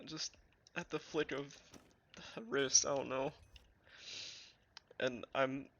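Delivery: talking through leftover tears; after crying. Real, not acted. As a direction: still teary, voice unsteady. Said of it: "I need to wipe away my tears".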